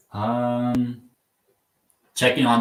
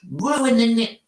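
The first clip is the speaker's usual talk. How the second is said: high, far-off mock British "Ruining it" with the t dropped; "Ruining it" sung up high like a Brit; mock British accent; 13 semitones higher than usual. Shown as a demonstration. Streamer mocking British slang, high mock British accent. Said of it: "watch my lips".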